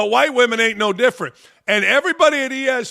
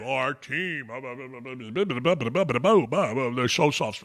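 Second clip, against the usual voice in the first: a limp, hushed parody of soft talent; mocking soft voice; 8 semitones lower, 7 dB quieter than usual. Mocking the ESPN hosts, lower and much softer.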